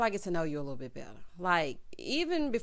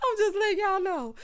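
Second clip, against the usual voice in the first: high, loud laughter spilling into the words; 10 semitones higher, 5 dB louder than usual. Real, not acted. Laughing with viewers about teachers, high and loud. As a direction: laugh hard, then get the line out.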